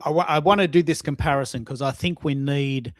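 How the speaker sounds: ordinary talk, nothing marked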